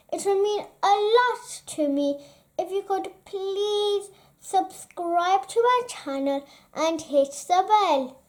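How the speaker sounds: ordinary delivery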